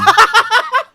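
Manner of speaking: a sudden, high, loud cackle of laughter; loud laughing burst. Real, not acted. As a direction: crack up hard and loud.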